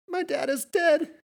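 high, slow giggling words; laughing. A narrator's reading. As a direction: laugh through the line. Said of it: "she laughed".

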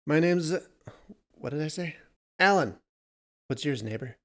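a lightly voiced strange man; odd, halting stranger; man's voice. A narrator's reading. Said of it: "addressed her from the marsh".

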